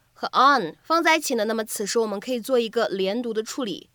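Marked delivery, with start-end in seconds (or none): none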